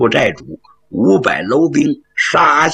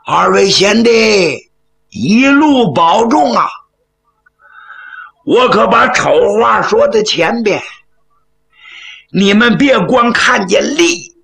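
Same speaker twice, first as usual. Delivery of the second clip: calling out loudly; 7 semitones higher than usual. A narrator's reading. Huang shouting from the high slope to his departing brothers, calling out loudly. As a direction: call after departing riders, voice pitched up. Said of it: "高声喊道".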